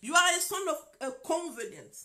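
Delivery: soaring, exultant pronouncement; exalted proclamation. Retold or imitated. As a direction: declare it like a prophecy over him. Proclaiming in English to the man.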